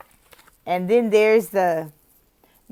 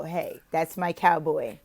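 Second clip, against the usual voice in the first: usual speaking voice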